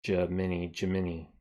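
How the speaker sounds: Jiminy tried out as juh-MIN-ee; Jiminy with a final "ee" sound; ee ending. Shown as a demonstration. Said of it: "how would I do it".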